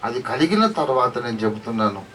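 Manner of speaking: ordinary talk, nothing marked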